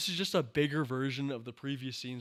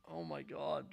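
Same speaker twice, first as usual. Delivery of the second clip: ordinary delivery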